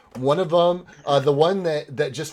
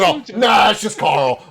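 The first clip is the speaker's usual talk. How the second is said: casual guard voice; 9 semitones higher, 10 dB louder than usual. Acted.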